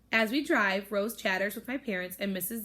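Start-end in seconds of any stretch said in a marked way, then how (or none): none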